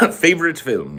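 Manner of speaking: "Favorite film" in a mock British accent. Shown as a demonstration.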